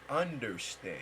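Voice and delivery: "understand" drawn out slowly, UNDER set apart; slow down and bring out the "under" in "understand"; stress on UNDER. Shown as a demonstration. Teacher breaking down the word, slow stress on UNDER in "understand". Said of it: "The under".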